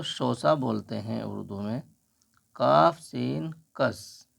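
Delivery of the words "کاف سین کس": very slow, کاف سین then کس each drawn out; slow spelling. Shown as a demonstration. Teacher slowly spelling letters for a student. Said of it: "کاف کو اوپر اٹھا کر کے بنائیں گے".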